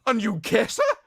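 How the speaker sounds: high, scandalised mock question; mock disbelief. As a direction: play the appalled critic. Imitating the scandalised critic.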